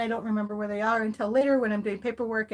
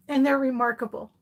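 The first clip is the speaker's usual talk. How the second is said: usual speaking voice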